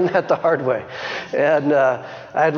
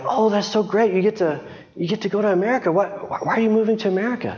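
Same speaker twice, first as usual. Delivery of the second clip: higher, quick, mock surprised teacher voice; 5 semitones higher, faster than usual. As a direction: lightly imitate the puzzled teachers. Teachers react to her moving.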